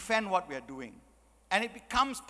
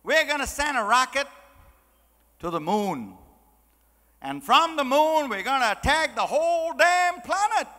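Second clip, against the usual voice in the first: put-on loud, high, brash American accent building to the threat; 5 semitones higher, 6 dB louder than usual. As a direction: play a booming American with a big plan. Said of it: "So the American says".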